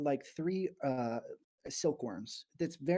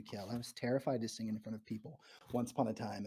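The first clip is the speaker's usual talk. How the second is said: ordinary talk, nothing marked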